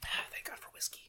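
breathy whisper of relief. Real, not acted. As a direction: sigh it out under breath. Relief after the shot.

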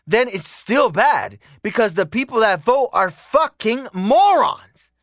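exasperated rant peaking on morons; building angry rant. Real, not acted. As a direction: start heated and climb to a near-shout. Ranting that voters are morons.

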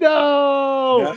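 drawn-out, high mock cry of refusal; imitated protesting wail. Little boy covering his butt during a spanking, imitated high wail. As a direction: wail it like a kid resisting.

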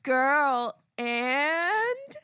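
loud, high, drawn-out show opener; podcast intro. Acted. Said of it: "I started saying, "What's poppin'?".

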